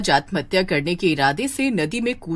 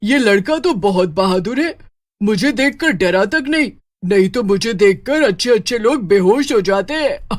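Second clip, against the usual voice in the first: louder witch voice, musing with delight; impressed witch; 5 dB louder than usual. Acted. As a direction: witch marvelling at a brave boy.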